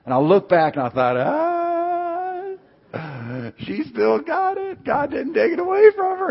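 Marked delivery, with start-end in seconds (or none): 1.2-6.3 astonished realization. Retold or imitated. Preacher acting out his thought on seeing her weep, high astonished squeal. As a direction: squeak it out in acted amazement.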